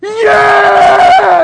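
high, loud triumphant "Yeah!"; cheering yell. Retold or imitated. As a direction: leap up and cheer. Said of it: "I was like".

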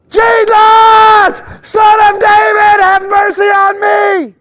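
high, slow, loud crying-out; desperate yell. Retold or imitated. Blind man calling out to Jesus over the crowd, desperate yell. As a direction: cry out for mercy at the top of your lungs. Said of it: "I gotta start yelling".

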